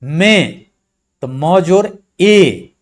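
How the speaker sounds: drawn-out letter breakdown; slow spelling out. Shown as a demonstration. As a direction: stretch म जोर ए out slowly for students. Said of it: "वर्ण विच्छेद करते हैं". (धीरे, खींचकर वर्ण बोलना)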